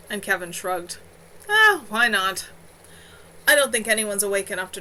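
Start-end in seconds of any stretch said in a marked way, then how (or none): none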